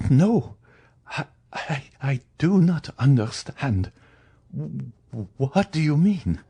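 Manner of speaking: high, faltering stammer of a frightened woman; high-pitched, bewildered stammering; overwhelmed stammer. Acted. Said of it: "stammered the unhappy woman".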